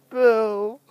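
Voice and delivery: ordinary talk, nothing marked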